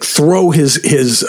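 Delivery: usual speaking voice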